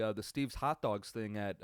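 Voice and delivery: ordinary talk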